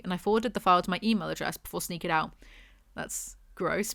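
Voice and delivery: ordinary talk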